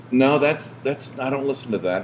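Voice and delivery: lightly voiced dismissive dude; retold brush-off.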